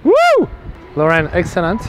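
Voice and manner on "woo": very high, loud "Woo"; whoop of joy. Real, not acted. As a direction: let out a delighted whoop. Cheering a winning shot.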